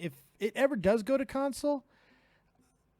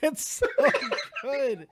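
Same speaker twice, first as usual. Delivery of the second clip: laughing; 6 semitones higher, 5 dB louder than usual. Real, not acted. Host laughing, praising voice.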